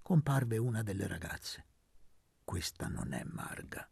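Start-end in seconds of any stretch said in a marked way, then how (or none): 2.4-3.9 hushed whisper. Acted. Elpidio whispering to Luca, hushed. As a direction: whisper it behind her back. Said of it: "disse Elpidio sottovoce a Luca".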